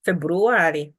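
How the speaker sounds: "February" as "Feburuwari" with a stressed long A; emphatic Chitumbuka pronunciation. Shown as a demonstration. Teacher demonstrates emphasis on the word, emphatic Chitumbuka pronunciation. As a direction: lean on the "ah" in "February", Chitumbuka style. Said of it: "emphasizing on the words".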